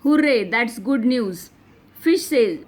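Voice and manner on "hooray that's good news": ordinary delivery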